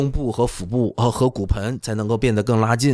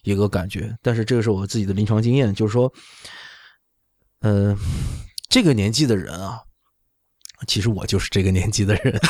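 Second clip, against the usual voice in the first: ordinary talk, nothing marked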